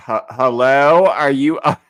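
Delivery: loud, rising mock call into an empty head; 1 mock exasperated calling. Host mocking the cast member, loud and rising. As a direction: call out as if knocking on her skull. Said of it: "I'm like".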